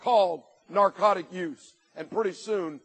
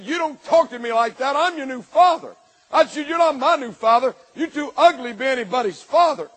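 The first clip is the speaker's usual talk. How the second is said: loud, much higher yelling back and forth; heated shouting match; 5 semitones higher, 8 dB louder than usual. Retold or imitated. Stepfather and teen arguing on the stairs, yelling. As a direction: yell it like a family fight.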